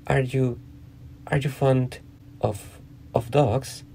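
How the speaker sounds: slow, faltering question with repeated starts; hesitant. A narrator's reading. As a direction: stumble carefully towards a new subject. Alice nervously changing the subject.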